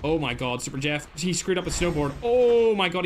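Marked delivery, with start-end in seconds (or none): 2.2-3.0 sudden loud, high oh my god; shocked outburst. Real, not acted. Caster reacting to a botched snowboard play, shocked shout. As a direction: blurt it out in disbelief.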